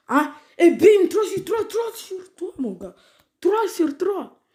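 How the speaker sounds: high, loud victory call; real celebration after winning; triumphant cheer. Real, not acted. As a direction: crow about the clean sweep. Celebrating three wins out of three.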